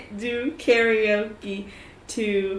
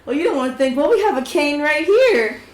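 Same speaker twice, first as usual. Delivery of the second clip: higher teasing reply; higher, louder playful tease; 5 semitones higher, 5 dB louder than usual. Retold or imitated. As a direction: needle him playfully. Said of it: "It's like".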